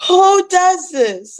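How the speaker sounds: milk the disbelief, even bigger; stretched-out incredulous wail; exaggerated outrage. Repeats outrage line.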